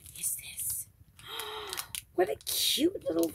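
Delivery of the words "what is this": soft, curious whisper; a quiet wondering whisper. Real, not acted. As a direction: hush it, peering at something. Discovering a hidden item.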